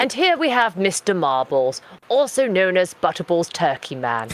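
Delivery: slow, posh British nature-documentary narration; measured posh British narrator; David Attenborough. Acted. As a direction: introduce a pet like rare wildlife, posh British. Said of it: "I'll do David Attenborough".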